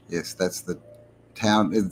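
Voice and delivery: ordinary talk, nothing marked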